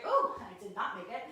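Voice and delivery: sudden loud oh on losing balance; startled slip. Real, not acted. Teacher wobbling out of warrior three, startled. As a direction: blurt it out as the balance goes. Loses balance during the pose.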